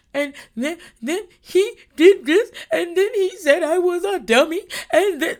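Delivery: put-on tattle like a sobbing little kid; imitating a worked-up crying child; upset kid. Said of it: "like, when a kid's really upset".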